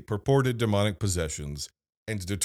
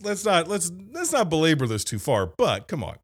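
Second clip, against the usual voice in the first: ordinary talk, nothing marked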